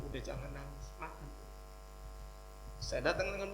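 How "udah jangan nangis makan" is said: very soft, gentle comfort; quiet, tender reassurance; soothing. Retold or imitated. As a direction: hush someone who is crying. Soothing the crying man.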